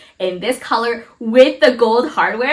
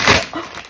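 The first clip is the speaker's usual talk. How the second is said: sharp gasp. Real, not acted. Unboxing reveal of full set.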